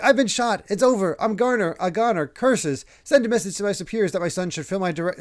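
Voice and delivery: high, hammed-up mock death throes; melodramatic dying speech. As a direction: play a victim overdramatizing his last words. Acts official's overblown dying speech.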